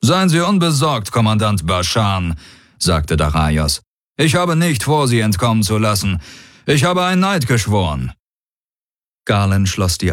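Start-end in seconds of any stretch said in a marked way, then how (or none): 0.0-2.3 higher-pitched, confident commander; self-assured warlord. Acted. Daraios answering the alien commander, self-assured and higher. 4.2-8.1 high, lifted commander voice; confident warlord. Acted. Daraios assuring the commander of his oath, high and confident.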